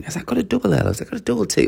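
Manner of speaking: acted dithering, pitched well up; unsure self-questioning. Unsure of English spellings, asking self high and soft.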